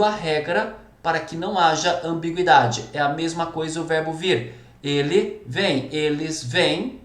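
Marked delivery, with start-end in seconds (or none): 4.8-7.0 "Ele vem, eles vêm" drawn out slowly, each form set apart; slow demonstration. Shown as a demonstration. Teacher slowly contrasts singular and plural for students. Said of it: "para diferenciar plural de singular".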